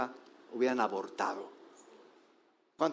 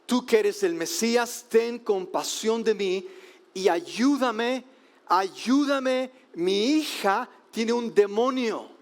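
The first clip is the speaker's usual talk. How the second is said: higher, louder desperate plea, slowed; desperate crying out; 6 semitones higher, 3 dB louder, slower than usual. A narrator's reading. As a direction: beg Jesus for help with full desperation. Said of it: "A gritos, con toda desesperación". (súplica desesperada a gritos)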